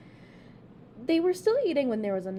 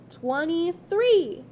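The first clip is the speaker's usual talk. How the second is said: slow, high, louder wonder; in awe; 7 semitones higher, 7 dB louder, much slower than usual. A narrator's reading. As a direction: marvel at the count. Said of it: "said Chicken in awe".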